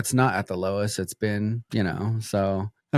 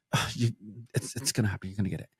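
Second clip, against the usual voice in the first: retold insisting mom; 9 dB quieter, much faster than usual. Mom to kid awaiting results, hushed insistence. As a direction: imitate mom in a hushed undertone. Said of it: "She's like".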